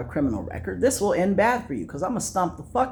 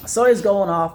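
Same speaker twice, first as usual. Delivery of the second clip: ordinary talk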